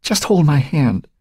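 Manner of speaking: high, slow plea; pained, drawn-out begging. A narrator's reading. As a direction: plead through pain. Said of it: "she begged".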